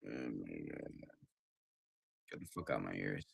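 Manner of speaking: low, soft, barely voiced defeat; exhausted mumble. Real, not acted. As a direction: let the words sink away. Fading, tired ending.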